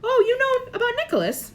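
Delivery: high bright delighted question; light up with delight. A narrator's reading. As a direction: Dumbledore pleased Harry knows. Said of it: "sounding quite delighted".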